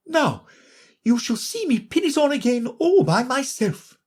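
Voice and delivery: high self-satisfied Queen voice; Queen showing off to Alice; proud Queen. A narrator's reading.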